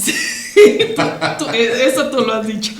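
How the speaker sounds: laughing loudly through the words. Real, not acted. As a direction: break into real laughter at the joke. Joking about husband's flaws. (riendo a carcajadas)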